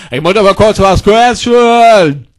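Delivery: slow, stretched-out demand; drawn-out insistence. Acted. Repeats request, drawing it out. (gedehnt und nachdrücklich)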